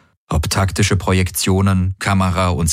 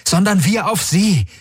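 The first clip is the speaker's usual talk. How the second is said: pitched up high, urgent and insistent; Galen, urgent young man; 14 semitones higher than usual. Acted. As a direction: press the point, high and keen.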